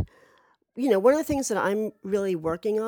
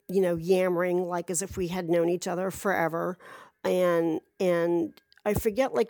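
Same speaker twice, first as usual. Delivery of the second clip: normal talking voice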